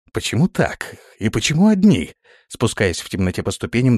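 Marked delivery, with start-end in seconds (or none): 0.1-2.1 higher, plaintive anxious questions; trembling voice. A narrator's reading. Prince descending dark stairs questions his guard, trembling. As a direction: ask nervously, rattled. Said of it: "дрожащим голосом спросил князь".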